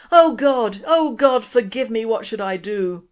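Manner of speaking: loud, pleading cry to heaven; crying out to God. Acted. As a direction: beg God for forgiveness, loud and high. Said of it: "got down on his knees and cried".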